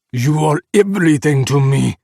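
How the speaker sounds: loud, urgent declaration; shouted. A narrator's reading. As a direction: throw it at him to break through. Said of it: "Sergei shouted".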